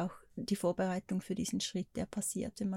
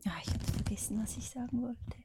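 leise wegflüsternd, Gedanke verloren; flüsternd abbrechen, ratlos; trailing off; 3 semitones higher, 7 dB quieter than usual. Real, not acted. Vergisst mitten im Satz den Gedanken. (leise verebbend, halb geflüstert)

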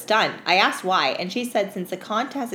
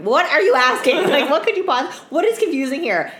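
high, loud incredulous disbelief; exasperated; 7 semitones higher, 5 dB louder than usual. Retold or imitated. Mocking a clueless customer, exasperated. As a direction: laugh through the disbelief. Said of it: "I'm like".

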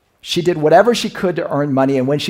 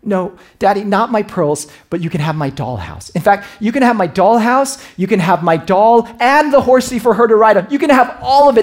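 high, loud building outburst; eager child; 7 semitones higher, 7 dB louder than usual. Retold or imitated. Daughter offering her father all her toys, rising loud. Girl gives everything.

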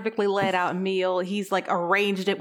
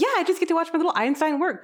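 higher and fast, gushing; eager robot; 8 semitones higher, much faster than usual. Retold or imitated. The robot gushes about watching her work. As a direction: rush it out in delight. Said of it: "He's like".

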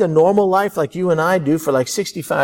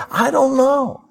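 despairing cry; 10 semitones higher, slower than usual. Acted. Despairing prayer to God.

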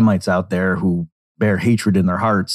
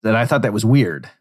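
ordinary talk, nothing marked

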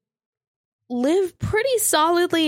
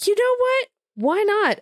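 excited; 11 semitones higher than usual. Retold or imitated. Retold inner thought, high.

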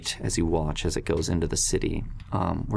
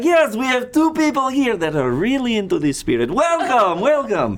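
loud high festive barker call; booming sing song welcome; 7 dB louder than usual. Acted. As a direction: boom it out like a carnival host.

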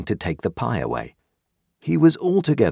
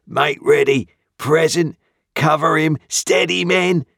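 louder and higher, like a drill sergeant; a convict mimicking soldiers' orders, louder; barked orders; 9 semitones higher, 5 dB louder than usual. A narrator's reading. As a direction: shout the commands as the soldiers would.

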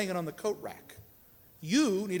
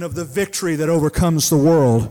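loud, higher climactic prayer, slowed; full-force preaching prayer; 4 semitones higher, 9 dB louder than usual. Real, not acted. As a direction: lift the church in triumphant prayer.

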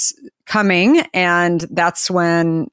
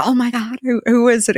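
excited; 7 semitones higher than usual. Retold or imitated. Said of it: "I was so excited".

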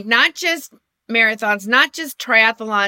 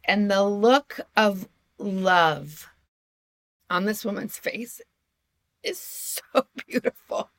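choked up tender; slower than usual. Real, not acted. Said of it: "I'm gonna get choked up".

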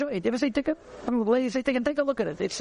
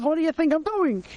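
higher-pitched mock indignation; indignant retort; 6 semitones higher than usual. Man answering the boy who corrected him, indignant. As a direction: act out a baffled comeback.

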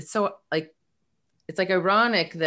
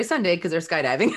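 usual speaking voice

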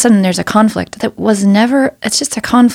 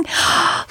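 gasp; 6 dB quieter than usual. Retold or imitated. Delighted gasp at passengers.

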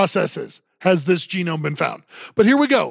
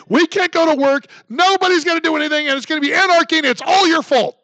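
higher, rising hysterical mock accusation; mock authorities ranting; 5 semitones higher than usual. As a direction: ham up the blaming officials. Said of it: "That's what they're saying right now".

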